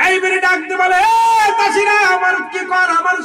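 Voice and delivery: high, loud summons; preacher's calling cry. A narrator's reading. Son calls the farmers to help, high loud preaching. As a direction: shout the plea out across a field.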